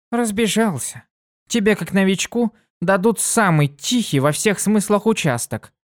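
высокий бойкий девичий голос; звонко и живо, как юная пегаска; бойкая пегаска. Acted. Дитси поддразнивает. (Дитси рассказывает другу, высоко и бойко)

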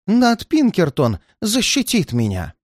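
put-on insistent old man.

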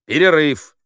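loud, high single call; commanding announcement; announcing. Acted. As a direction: call it out to the whole room. Political officer announces a break.

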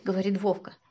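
ordinary delivery, nothing marked

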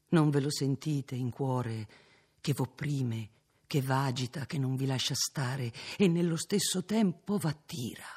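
ordinary delivery, nothing marked

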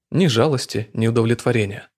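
plain reading voice

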